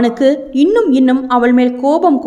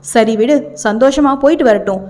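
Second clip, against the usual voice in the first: plain reading voice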